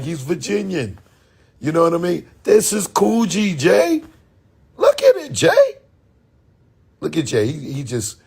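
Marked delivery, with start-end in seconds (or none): 2.4-5.8 building higher with each line; exasperated pleading. Retold or imitated. Pleading with Jay to look, climbing high. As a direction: rise higher and higher, begging Jay to see.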